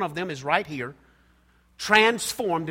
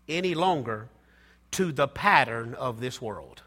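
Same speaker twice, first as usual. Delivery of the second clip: scripture reading; 4 semitones lower than usual. Acted. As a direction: read the verse slowly, letting each phrase land. Reads Romans 12:2 aloud.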